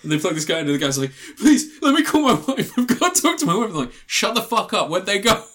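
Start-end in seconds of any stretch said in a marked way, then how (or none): 1.4-3.7 high, loud, laughing plea; desperate pleading. Retold or imitated. Dead man begs to call his wife, laughing high plea. 4.1-5.4 high mock barked order; hammed-up soldier snap. Soldiers snap at the dead man, a mock high bark. Voicing soldiers in film scene.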